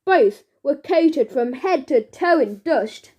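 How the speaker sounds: usual speaking voice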